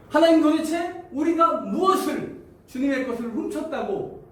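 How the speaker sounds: high, loud accusing question; indignant demand. Acted. Israelites demanding proof from God, high loud protest. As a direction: challenge God outright. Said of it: "이렇게 따집니다".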